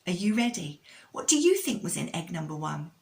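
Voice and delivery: usual speaking voice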